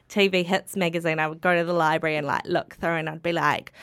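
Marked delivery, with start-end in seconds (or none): none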